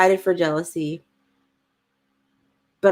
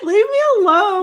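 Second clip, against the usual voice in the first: much higher, louder comic wail; whiny mock protest; 12 semitones higher, 5 dB louder than usual. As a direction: play up being pestered, high and loud. Mock quarrel with teasing co-host.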